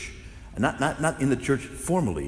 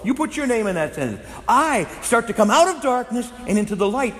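higher and louder, fervent preaching build; full-force preaching; 6 semitones higher, 5 dB louder than usual. Acted. Preacher rousing the congregation, higher, louder and fervent. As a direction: preach it out with mounting fire.

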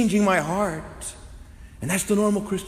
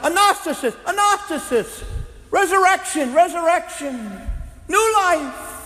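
much higher, louder proclaiming cry; 14 semitones higher, 6 dB louder than usual. Retold or imitated. Preacher voicing Paul crying out resurrection, high and loud. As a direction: cry each word out like a herald. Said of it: "then he'd start crying out".

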